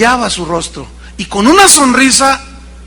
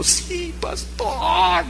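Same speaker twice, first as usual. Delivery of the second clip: soft tearful yes; 9 semitones higher, 11 dB quieter, much slower than usual. Retold or imitated. Woman's soft tearful answer.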